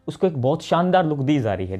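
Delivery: ordinary delivery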